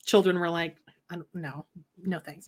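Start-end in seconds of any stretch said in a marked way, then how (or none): 1.1-2.5 soft shy mumble; quiet timid refusal. Retold or imitated. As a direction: decline timidly, softly. Voicing shy kids.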